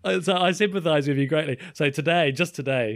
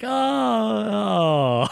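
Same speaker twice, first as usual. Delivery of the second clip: mock realisation; 7 semitones higher than usual. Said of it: "And it was like".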